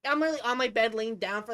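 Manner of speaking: ordinary talk, nothing marked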